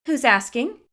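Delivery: put-on a suspicious girl demands who's calling; demanding. A narrator's reading. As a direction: push back at the stranger. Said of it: "she demands".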